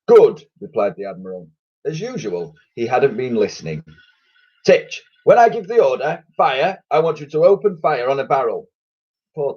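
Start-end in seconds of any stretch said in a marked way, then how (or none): 0.1-0.4 loud high pompous good; brisk admiral. A narrator's reading. Admiral not listening. 4.7-8.7 commanding admiral. A narrator's reading. Admiral giving orders.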